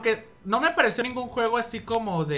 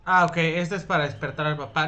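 normal talking voice

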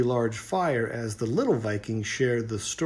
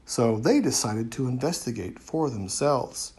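usual speaking voice

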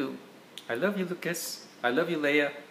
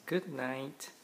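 soft firefly voice; 10 dB quieter than usual. Acted. Firefly says good night.

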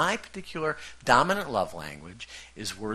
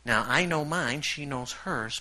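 normal talking voice

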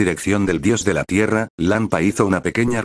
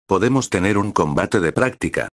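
ordinary delivery

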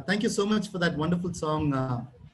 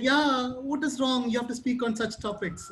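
imitating Achin, surprised; 8 semitones higher than usual. Voicing Achin insisting.

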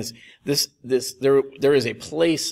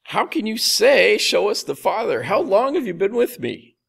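higher, louder exasperated appeal; a little exasperated; 7 semitones higher, 4 dB louder than usual. Retold or imitated. Jesus to Philip who still hasn't understood, exasperated. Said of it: "he seems a little exasperated here".

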